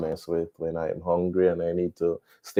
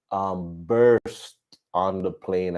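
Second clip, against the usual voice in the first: ordinary talk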